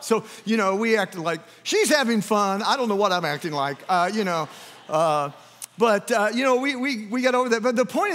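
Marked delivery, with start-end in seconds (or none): none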